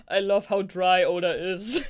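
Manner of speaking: real laugh-talk; laughing while talking. Real, not acted. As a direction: gush through a laugh.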